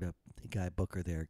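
ordinary delivery

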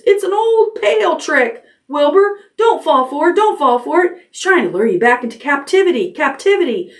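high, insistent goose voice; goose warning. A narrator's reading. Goose warning the pig off the farmer's bait, high urgent plea.

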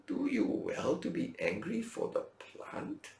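slow, soft questioning voice; gentle God. Acted. Preacher repeating God's question to Jonah, slow and soft. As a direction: ask it gently and slowly. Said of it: "And God said to Jonah".

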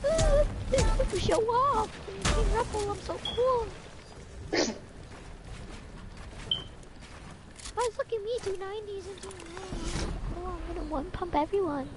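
high-pitched mocking kid voice; mocking tryhard kid. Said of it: "This kid's like".

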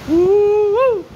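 high, loud whooping cheer; excited whoop. Real, not acted. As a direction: whoop into the wind. Cheering at the hurricane wind.

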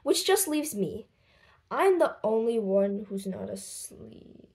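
plain reading voice

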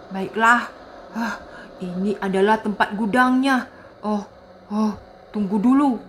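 low, scheming mutter of a prowling villain; sneaky villain voice. Acted. Villain sneaking into the house, low and scheming. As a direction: creep around like a villain plotting in the dark.

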